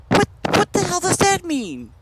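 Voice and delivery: high-pitched mock disbelief; incredulous outburst. Friend hearing mucky muck during a game, incredulous. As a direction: imitate a totally baffled friend.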